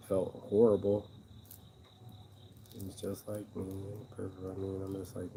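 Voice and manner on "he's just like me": ordinary talk, nothing marked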